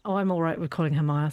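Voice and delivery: ordinary talk, nothing marked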